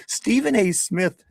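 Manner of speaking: high put-on English accent, breaking into laughter; laughing mock English accent. Host cracking up while mimicking a British listener in an English accent. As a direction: try the English accent again through a laugh. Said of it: "this is how he sounds in my head. He's English".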